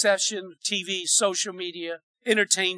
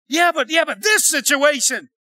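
raised-pitch mock protest; protesting doubter; 6 semitones higher, 4 dB louder than usual. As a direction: play the doubter making excuses. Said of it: "You just told me".

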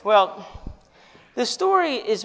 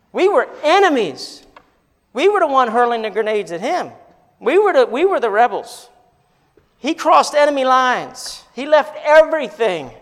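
loud, higher impassioned preaching; preaching at full force; 5 semitones higher, 6 dB louder than usual. Real, not acted. Pastor preaching to the congregation, impassioned. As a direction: drive the gospel point home.

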